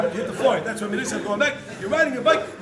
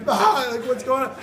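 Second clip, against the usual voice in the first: high loud startled cry; shocked; 8 semitones higher, 8 dB louder than usual. Retold or imitated. Kid hitting the floor, high loud shock. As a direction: caught off guard mid-fall.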